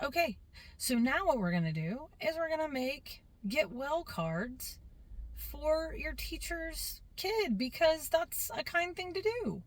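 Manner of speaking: a hint of talking to a class; teacher addressing kids.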